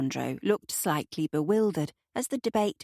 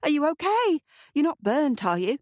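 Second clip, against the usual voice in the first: higher, louder, alarmed apology; alarmed cry; 10 semitones higher, 4 dB louder than usual. A narrator's reading. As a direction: blurt out in sudden alarm. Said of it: "Kate cried".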